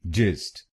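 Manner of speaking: जिस्ट said alone as one syllable; syllable demo. Shown as a demonstration. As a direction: model the syllable जिस्ट for learners. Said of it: "आखिरी शब्दांश को बोलिए जिस्ट". (शब्दांश जिस्ट अलग से बोलें)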